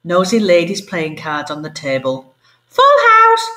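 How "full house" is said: very high, loud bird squawk; cockatoo squawk. Acted. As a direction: shriek it like a parrot. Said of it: "squawked Clive".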